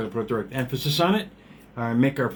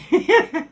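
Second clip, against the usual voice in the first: high laugh; 7 dB louder than usual. Real, not acted. Nervous reaction to Los Angeles.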